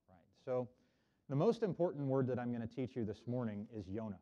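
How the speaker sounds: ordinary delivery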